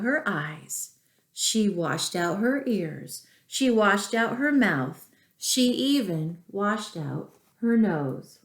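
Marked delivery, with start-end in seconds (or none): none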